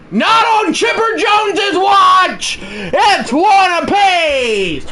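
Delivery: high, loud, slow triumphant yell; excited shout. Real, not acted. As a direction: cheer it like a home run call. Home run ties the game.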